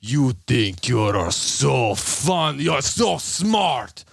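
slow, mock Russian-Mexican scammer accent; Indian-Mexican-Russian accent. Scammer scolding the prankster, slow imitated Russian-Mexican accent. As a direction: drag out a muddled foreign accent. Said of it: "Russian Mexican".

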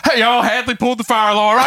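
high-pitched announcing call; gleeful tattling. Retold or imitated. Kid telling everyone about Hadley, calling out. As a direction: imitate someone broadcasting news to a crowd. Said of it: "turned around and went".

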